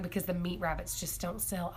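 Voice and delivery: quoting the clerk in a hushed whisper; whispered aside. Retold or imitated. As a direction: drop to a secretive whisper. Quoting clerk's confiding remark.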